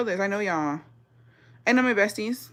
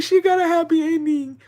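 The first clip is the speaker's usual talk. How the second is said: high-pitched retold reaction; squeaky re-enacted relief; relieved squeal; 9 semitones higher than usual. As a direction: repeat it high, acting out your relief. Retelling own relieved reaction to film.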